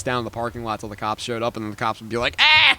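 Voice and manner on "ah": mock startled yelp. Cops' mock reaction.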